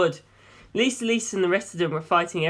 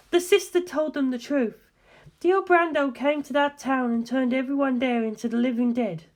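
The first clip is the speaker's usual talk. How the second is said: put-on young woman, higher than the narration, steady and serious; Darlington's voice; 9 semitones higher than usual. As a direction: lay out the findings calmly, like a briefing. Darlington speaks, narrated by George.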